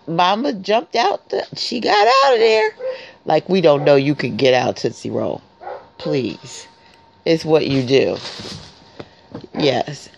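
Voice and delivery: normal talking voice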